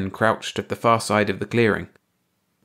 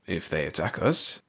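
nervous mutter; 3 semitones lower, 6 dB quieter than usual. A narrator's reading. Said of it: "Clark muttered nervously".

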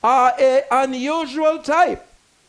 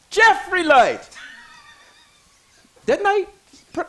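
usual speaking voice

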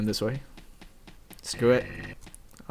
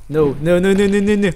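rapid, higher, louder string of no; panicked no; 6 semitones higher, 5 dB louder than usual. Real, not acted. Gamer fleeing an enemy, a higher, louder panicked string of no. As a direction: blurt no over and over as it closes in.

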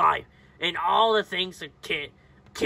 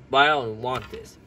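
ordinary talk, nothing marked

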